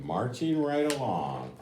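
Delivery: ordinary delivery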